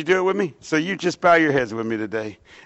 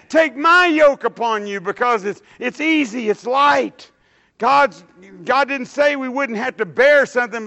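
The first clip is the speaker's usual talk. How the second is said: raised, emphatic sermon delivery; preaching; 9 semitones higher, 4 dB louder than usual. Acted. Preacher exhorting the congregation, loud and emphatic.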